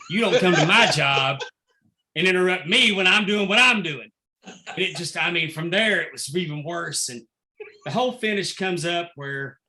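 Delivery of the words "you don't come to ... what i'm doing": louder, half-laughing mock rebuke; retold telling-off. Manager to a relentless heckler, loud mock telling-off. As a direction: raise your voice, indignant but amused.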